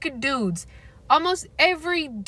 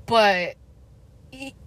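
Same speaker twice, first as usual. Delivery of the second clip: normal talking voice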